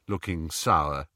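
ordinary delivery, nothing marked

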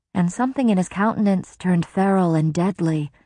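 ordinary delivery

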